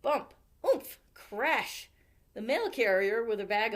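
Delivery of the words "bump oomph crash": sound effects. A narrator's reading.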